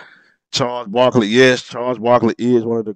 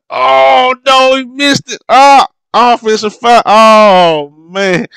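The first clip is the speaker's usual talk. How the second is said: excited outburst; 11 semitones higher, 5 dB louder, slower than usual. Real, not acted. Watching the replay, groaning at a missed shot, excited and high. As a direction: pitch jumps high and loud, real dismay.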